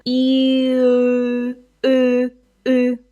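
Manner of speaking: U said with the tongue pulled back from E; Russian Ы sound. Shown as a demonstration. Teacher shows learners the Russian Ы sound. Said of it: "pull your tongue back".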